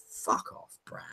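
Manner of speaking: hushed whispered insult. Retold or imitated. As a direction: breathe it out dismissively. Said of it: "everybody's just like".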